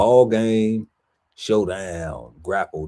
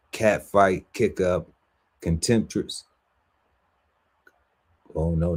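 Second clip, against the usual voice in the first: ordinary talk, nothing marked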